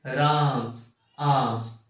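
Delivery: राम and आम with the vowel held very long and slow; राम and आम spoken with each vowel held about three times as long as normal; drawn-out pluta vowels. Shown as a demonstration. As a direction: stretch the vowel in राम and आम to triple length. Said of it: "तीन गुना समय लगता है उसे पुलुत स्वर कहते हैं".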